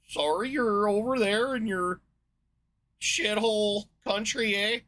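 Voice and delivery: high, loud, slow mock Canadian letter-writer, eh; mock Canadian accent. Scandinavian letter-writer pities Americans, in a mock Canadian accent. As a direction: put on a jokey Canadian accent, drawing out the apology. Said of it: "Or that's Canadian".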